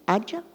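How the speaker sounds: "Adja?" said soft, with a rising question tune. Shown as a demonstration. Showing students a question tone, soft rising "Adja?". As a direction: ask "Adja?" quietly, pitch going up at the end. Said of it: "como se eu estivesse fazendo uma pergunta".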